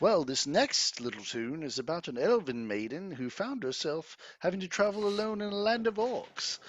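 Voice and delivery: lilting performer's patter with swooping pitch; bard introducing song. Acted. Bard presents a tune about an elven maiden, stage patter. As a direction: announce the tune to a small crowd.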